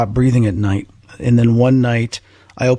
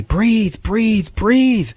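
high-pitched urgent mock yell, no extra volume; retold yelling; 10 semitones higher, much slower than usual. Storyteller reenacting yelling at their own lifeless body, urgent mock yell. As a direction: urge it on with pitch jumping high. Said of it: "I'm yelling at my body".

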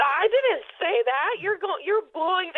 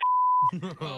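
bitter scoff of disbelief; scoffing laugh. Real, not acted.

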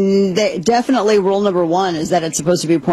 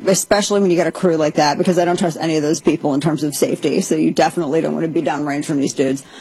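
normal talking voice

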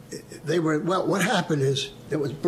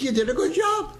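high, pleased approving voice; the dentist giving a compliment; returning dentist praising his fillings; lightly voiced dentist praise; 12 semitones higher than usual. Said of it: "he said".

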